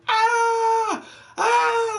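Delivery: very high strained mock screams of a little girl; imitated child scream. Retelling the girl screaming in the video, high mock shriek. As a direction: shriek it like a terrified kid. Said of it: "hear the little girl screaming".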